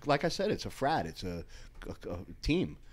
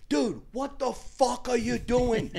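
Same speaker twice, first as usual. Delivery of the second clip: retold yelling; 10 semitones higher than usual. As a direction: bawl him out from across the gym, pitched way up. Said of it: "I'm yelling".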